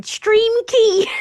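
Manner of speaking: loud, high Scouse yell of stream key; Scouse accent mock yell; mock Scouse shout. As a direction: imitate a frantic Scouser. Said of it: "a Scouser going".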